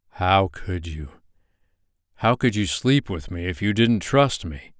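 plain reading voice

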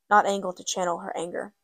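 ordinary delivery

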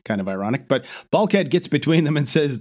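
ordinary delivery

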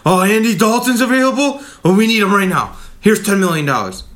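high, loud, frantic mocking voice; mock panicky GM. Fan mocking Ryan Pace for panic-signing players, frantic high squeal. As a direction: squeal it like a GM grabbing every quarterback. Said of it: "he jumps the gun way too much".

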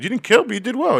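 high, loud mock voice for Celebrity's praise; hammed-up high-pitched encouragement. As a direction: squeak out the compliment way up high. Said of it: "He goes".

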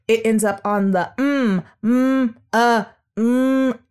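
MM held and pressed hard; stress on mm. Shown as a demonstration. Therapist demonstrating misplaced stress. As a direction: land the weight on the mm. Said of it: "instead of the stress being on the ah".

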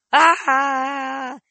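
put-on high startled scream from the old man. A narrator's reading. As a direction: shriek in surprise. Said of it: "he screamed".

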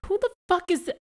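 very high-pitched, incredulous squeak; comically baffled falsetto; mock bafflement. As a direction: imitate bewildered disbelief way up high. Repeats his baffled reaction.

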